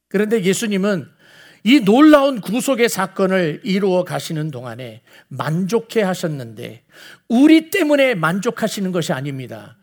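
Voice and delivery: ordinary delivery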